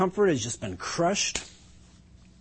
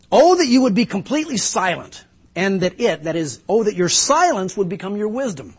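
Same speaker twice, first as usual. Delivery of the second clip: louder, higher cutting retort; sharp-edged rebuke; 5 semitones higher, 6 dB louder than usual. Acted. Job telling his friends to be silent, sharp and loud. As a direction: cut them down, demand their silence. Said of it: "There's a sharp edge to it".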